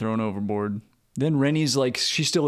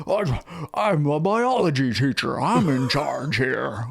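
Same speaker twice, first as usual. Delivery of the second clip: slow, drawn-out Jimmy Stewart drawl, lightly done; a hint of Jimmy Stewart, slow and earnest; Jimmy Stewart impression; 2 semitones higher, slower than usual. Podcaster mimics the biology teacher taking charge, as a loose Jimmy Stewart. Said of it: "Turns into Jimmy Stewart".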